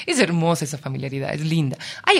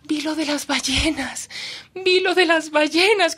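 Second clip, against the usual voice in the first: high, slow, delighted; joyful; 10 semitones higher, 4 dB louder than usual. Retold or imitated. Strangers greeting the reporter, high joyful voice. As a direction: say it with bursting joy. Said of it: "con esa alegría".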